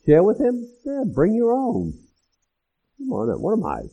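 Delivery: indignant rich guest. Retold or imitated. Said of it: "You can almost hear them making their case".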